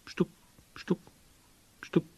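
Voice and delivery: spaced knock syllables; knocking imitation. A narrator's reading. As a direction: toneless tapping noise. Narrator resumes imitated knocking.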